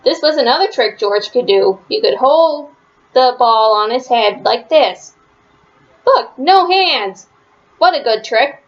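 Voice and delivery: ordinary delivery, nothing marked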